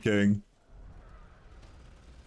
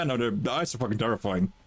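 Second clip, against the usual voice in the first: ordinary talk, nothing marked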